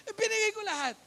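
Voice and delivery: high, loud eager exclaim; proud that you gave it all; excited. Retold or imitated. Excited retelling giving savings. (masiglang bulalas)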